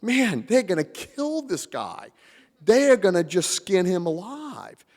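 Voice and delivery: fear for the guest's life; alarmed inner voice. Retold or imitated. Speaker whispers fears to the Mexican delegate, alarmed and high. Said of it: "I'm like".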